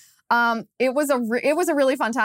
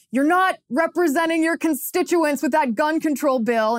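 scolding heckle, pitched higher; heckling; 6 semitones higher than usual. Retold or imitated. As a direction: call out a reproach to a passing candidate. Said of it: "And my dad goes".

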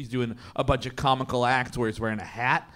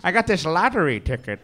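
Bruce Dern; 3 semitones higher than usual. Acted. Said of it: "Bruce Dern and, uh, Teen Wolf".

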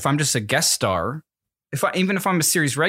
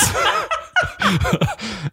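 high laugh. Real, not acted. Laughing mid fake message.

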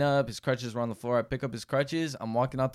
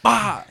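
impact shout; 9 semitones higher, 8 dB louder than usual. Retold or imitated. Retelling throwing crutches.

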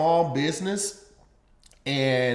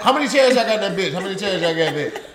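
quick, hammed-up slang; mock barbershop caller; 5 semitones higher, 5 dB louder, faster than usual. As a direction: fire it off as a put-on caller.

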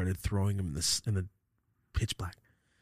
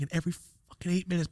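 normal talking voice

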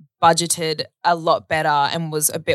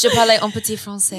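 put-on French accent; 4 semitones higher, faster than usual. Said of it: "teach you a little bit of French".

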